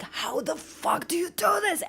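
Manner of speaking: high, slow exasperated mock wail; retold meltdown. Streamer recalling being stuck in Zelda, retold meltdown. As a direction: re-enact your own puzzle meltdown. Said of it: "I had complete meltdowns playing Zelda".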